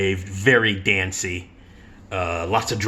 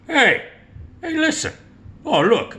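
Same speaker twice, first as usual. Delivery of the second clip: startled recognition. Retold or imitated. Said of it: "And he goes".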